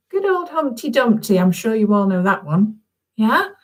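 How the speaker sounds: normal talking voice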